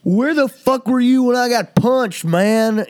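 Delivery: high, loud mock yell of an angry drunk; imitating a drunk yelling in his face; drunken screaming. Drunk guy screaming at Sam outside the door, high mock yell. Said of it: "the guy is screaming at Sam".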